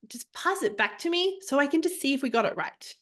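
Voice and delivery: high-pitched, acted-out caution; coaching instruction. Doctor acting out a request to staff, high cautioning. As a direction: instruct them in a lifted, careful voice.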